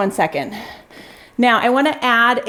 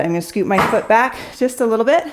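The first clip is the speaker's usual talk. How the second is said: normal talking voice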